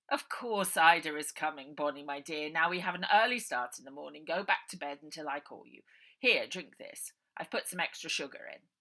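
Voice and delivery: loud, falsely kind uncle voice; smooth villain. A narrator's reading. Uncle Arthur sending Bonnie to bed, falsely kind. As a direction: soothe the child while hiding menace.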